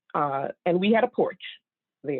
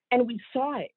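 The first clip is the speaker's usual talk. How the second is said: ordinary talk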